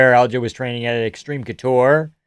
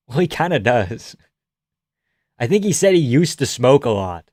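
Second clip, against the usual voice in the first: ordinary delivery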